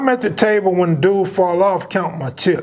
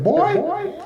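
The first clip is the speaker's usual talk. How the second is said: put-on old Southern lady; 11 semitones higher, slower than usual. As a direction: put on a high old Southern lady's voice, calling him over. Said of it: "her southern accent".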